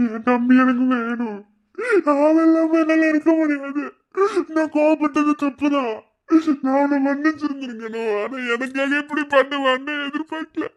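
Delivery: a sister crying that she needs her brother; weeping plea. Acted. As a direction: sob out the regret.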